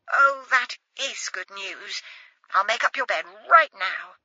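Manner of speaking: a timid posh mother thrilled her daughter is coming home; excited mother. Acted. Mother replies on the phone.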